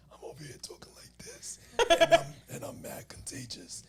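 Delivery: hoarse strep-throat whisper, almost no voice; raspy sick voice. Retold or imitated. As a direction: rasp it out like you've lost your voice. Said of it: "that sounded a little too raspy".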